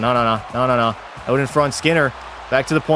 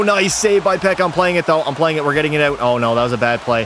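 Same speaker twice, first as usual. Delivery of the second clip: normal talking voice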